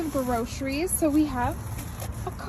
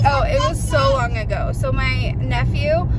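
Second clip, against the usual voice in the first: ordinary talk, nothing marked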